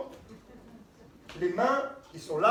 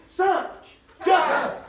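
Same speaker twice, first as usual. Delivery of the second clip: shouted countdown; 9 semitones higher, 7 dB louder than usual. Real, not acted. Audience countdown to start the scene.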